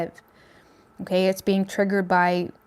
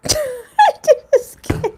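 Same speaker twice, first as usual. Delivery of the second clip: high squeaky laughing words; words lost in giggles; real laughing fit; helpless laughter; 6 dB louder, much slower than usual. Real, not acted. Giggle fit during reading.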